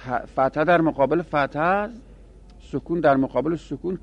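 ordinary delivery

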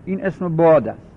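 usual speaking voice

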